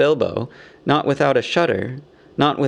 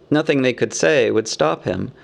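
plain reading voice

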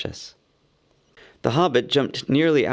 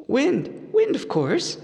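higher, relieved hobbit; pleased hobbit; much slower than usual. A narrator's reading. Bilbo solving Gollum's riddle, relieved. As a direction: announce it with relief. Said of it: "he said".

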